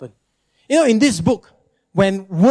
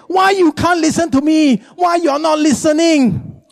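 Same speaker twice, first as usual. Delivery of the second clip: mock nagging wail; 9 semitones higher than usual. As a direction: whine it as an exasperated wife. Nagging wife protests to absent-minded husband.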